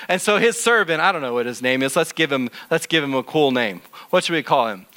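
ordinary delivery

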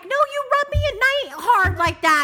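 high, loud laughing complaint; mock scolding. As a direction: scold him half-laughing.

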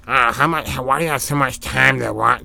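put-on voice mimicking cable-TV critics; mock the people who judge your TV time; mocking naysayer voice. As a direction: imitate an annoying nag. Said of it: "That's how they sound".

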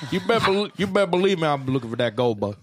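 playful Southern prospector impression; mock prospector brag. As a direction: brag about tiny gold like an old miner.

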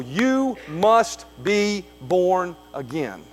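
slow, weighty "You must be born again"; emphatic. Acted. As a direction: lean hard on "must" and draw the line out slowly. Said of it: "Must is emphatic".

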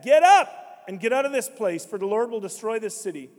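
loud, high cry to flee; loud warning to get out; urgent warning. Acted. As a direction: shout the warning to run. Angels warning Lot, quoted.

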